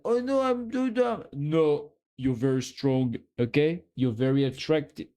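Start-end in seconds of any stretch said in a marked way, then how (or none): 0.0-1.2 much higher mock of a timid friend; mocking imitation. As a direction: parody self-doubt, whining. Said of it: "you know, like".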